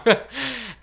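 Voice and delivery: a high burst of laughter. Real, not acted. Admitting she was wrong, laughing high. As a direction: let a sheepish laugh out.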